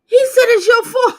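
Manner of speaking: high, loud, heated exclamation; shouting it out in outrage. Real, not acted. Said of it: "I'm getting heated".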